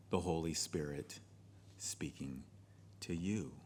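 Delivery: slow and very soft, dropping to almost nothing; hushed, unhurried pastoral answer; calm, deliberate reply. Shown as a demonstration. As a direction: say it quietly and let each phrase land after a pause. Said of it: "Pause and say to them".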